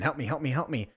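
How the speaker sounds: ordinary delivery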